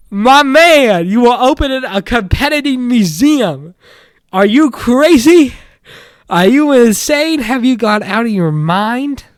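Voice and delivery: loud, incredulous scolding; incredulous yelling. Real, not acted. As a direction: yell at him like he's lost his mind. Incredulous rant at Matt.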